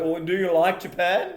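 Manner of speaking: mimicking an annoying question, high; mocking quote. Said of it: "the next question that annoys me".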